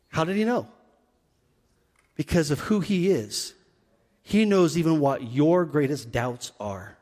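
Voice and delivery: ordinary talk, nothing marked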